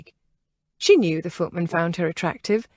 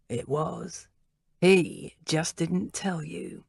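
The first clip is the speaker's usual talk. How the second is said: cold quiet voice; 6 dB quieter, slower than usual. Acted. Leader coldly answers.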